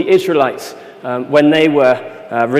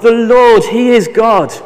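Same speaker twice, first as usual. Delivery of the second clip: even higher and louder cry; louder second declaration; louder proclaiming; 8 semitones higher, 8 dB louder, much slower than usual. Acted. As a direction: build it to a climax, proclaiming. People fall, repeat louder.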